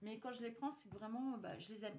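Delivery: normal talking voice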